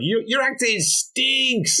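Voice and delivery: slow, high mock jeer; put-on heckler from the back; Mary Jane's heckle. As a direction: drag out the insult in a high voice. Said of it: "Mary Jane's like in the background".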